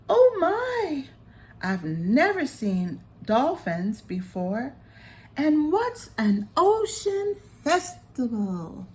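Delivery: put-on high, excited child-like voice; butterfly asking eagerly about the festival; amazed little butterfly. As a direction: squeal it out full of wonder.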